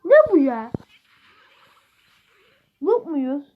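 high, loud what-is-this cry; shocked gamer cry; surprised outrage. Real, not acted. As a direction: yelp it in disbelief. Reacting in game.

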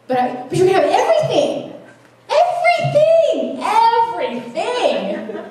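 very high, slow drawn-out everything; beg, stretching each everything; high, drawn-out laughing plea; pleading. Retold or imitated. Pleading with God, quoted.

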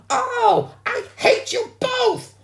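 slow, very high, loud shriek; furious schoolgirl. A narrator's reading. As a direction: shriek each word in a stamping tantrum. Sheila stamps her foot, furious.

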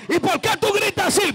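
high-pitched mock challenge from a critic; challenging question. Critic asking a worshipper why they shout, challenging. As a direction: throw the question up high, accusingly.

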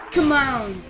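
usual speaking voice

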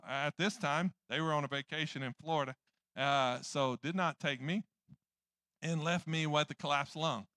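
ordinary talk, nothing marked

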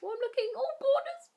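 high, thrilled exclamation; excited. Real, not acted. Opening a pack, he sees the card rising, excited. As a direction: gasp it out as the card flips.

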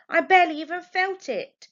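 loud, high protest; indignant teen protest. A narrator's reading. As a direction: push back hard against the fuss. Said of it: "she protested".

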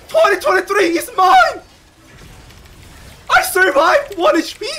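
high victorious yell; triumphant shout. Real, not acted. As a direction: scream it in triumph. Survives with one HP.

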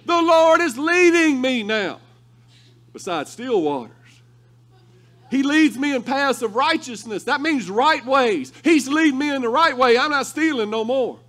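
loud, high preaching. Acted. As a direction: preach it to the congregation. Preaching at full force.

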